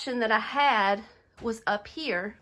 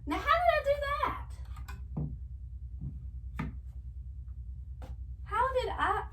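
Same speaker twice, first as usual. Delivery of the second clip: puzzled squeak; 6 dB quieter than usual. Real, not acted. Puzzled over the mixed-up pictures.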